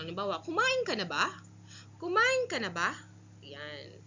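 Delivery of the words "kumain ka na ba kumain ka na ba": Kumain ka na ba? said high and loud, rising at the end; rising question tune. Shown as a demonstration. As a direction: ask it with a lifting question tone. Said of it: "pataas yung tono ng nagtatanong".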